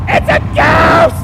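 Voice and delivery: high, drawn-out terrified shout; shouted in fright. Acted. Disciples spotting a ghost on the sea, frightened shout. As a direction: scream it out as if badly spooked. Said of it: "they shouted".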